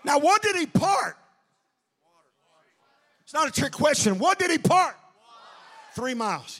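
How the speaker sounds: loud, high preaching shout; preaching at full force. Acted. Preacher shouting to the church. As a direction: preach it out to the congregation.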